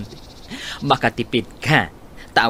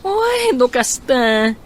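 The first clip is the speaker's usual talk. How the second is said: surprised; 11 semitones higher, 4 dB louder, slower than usual. Acted. Friend reacts to news.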